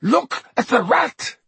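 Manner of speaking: high, urgent cry; desperate. A narrator's reading. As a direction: point and plead frantically.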